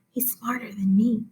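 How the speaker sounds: breathy whisper of a confession; whispered aside. Acted. Narrator confiding to the reader in a whisper. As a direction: let it slip out as a secret whisper.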